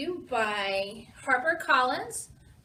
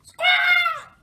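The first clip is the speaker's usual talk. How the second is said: very high, loud parrot screech; parrot squawk; 10 dB louder than usual. A narrator's reading. Parrot flying to Captain Pete's ship, piercing squawk. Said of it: "says the parrot".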